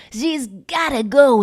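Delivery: high, loud, drawn-out drama; melodramatic repeat. Acted. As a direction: stretch it out slow and high, hamming it up.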